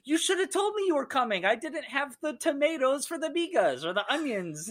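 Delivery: high, gushing mother voice; delighted hostess. Retold or imitated. Mercedes greeting Rafa at night, high delighted gushing. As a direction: gush with surprised delight.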